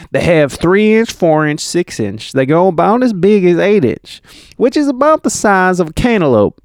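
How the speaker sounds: high-pitched southern eyewitness; southern accent. Acted. Reading Kofi's account of mortar sizes, southern accent. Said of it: "I'm just gonna do a southern accent".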